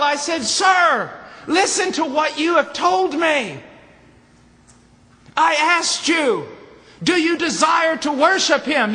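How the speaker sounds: high, loud, slow preaching shout. Retold or imitated. Preacher recounting his reply to the angry man, shouted slowly. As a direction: bellow each question and answer. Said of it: "preacher shouting the retold exchange".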